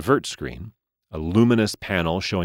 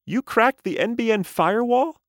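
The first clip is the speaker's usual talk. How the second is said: very high, alarmed doll voice; shocked toy squeal; talking doll. A narrator's reading.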